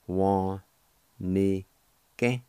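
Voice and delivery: Wa, ni, ke in three separate syllables. Shown as a demonstration. Teacher pronouncing the word for learners, syllable by syllable. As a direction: sound out "Wa, ni, ke" with a gap after each. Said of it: "it's pronounced like this".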